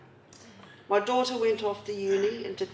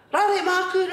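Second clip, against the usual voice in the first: put-on high-pitched, louder kid's plea; child begging; 9 semitones higher, 4 dB louder than usual. Little son begging his nanny for sweets, high childish plea. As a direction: beg sweetly like a little boy. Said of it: "run into the nanny's room and go".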